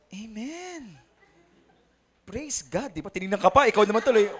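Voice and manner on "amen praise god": soft, rapt churchgoer's response; imitating devout seatmate. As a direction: breathe it out softly in mock devotion. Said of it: "ganito siya, oh". (mahina at taimtim kunwaring pagsamba)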